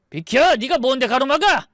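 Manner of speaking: loud, high-pitched challenge; angry order. Acted. Brother-in-law blocked by the baby's father, angry.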